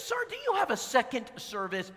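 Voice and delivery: put-on high, sweet older lady voice; preacher voicing the visitor Ellen, high and sweet. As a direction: play her as a sweet lady.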